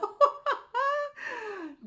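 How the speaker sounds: a high, loud delighted laugh; high burst of laughter. Real, not acted. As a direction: let a happy laugh burst out. Laughs at own reading.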